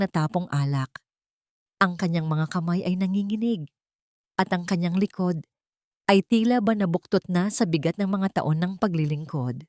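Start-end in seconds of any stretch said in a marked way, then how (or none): none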